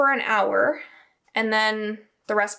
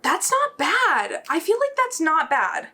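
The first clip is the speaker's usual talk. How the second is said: higher, louder, slow pleased surprise; 6 semitones higher, 4 dB louder than usual. Real, not acted. Baker cutting her first loaf, pleased surprise. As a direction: let the relief lift the voice.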